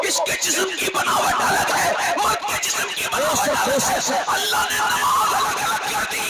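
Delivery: loud, high-pitched sermon shouting; full-force pulpit preaching, rising to shouts; fiery preaching. Acted. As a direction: thunder it at the congregation.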